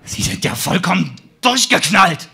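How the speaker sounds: loud, high angry snap; snapping. Acted. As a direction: bark it at him furiously. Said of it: "schnauzte ich ihn an".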